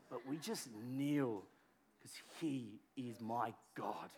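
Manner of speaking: bow your voice down low; humble and quiet. Shown as a demonstration. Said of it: "we don't do it like that".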